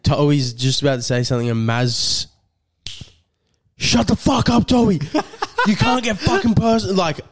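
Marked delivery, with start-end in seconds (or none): 3.8-7.0 high-pitched mock outburst; imitated angry snap. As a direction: cut him off as an angry mate would. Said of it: "Maz snaps".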